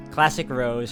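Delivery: ordinary talk, nothing marked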